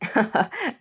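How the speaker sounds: short acted snicker; mocking laugh. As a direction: snicker at someone's expense. Boys mock her swimming.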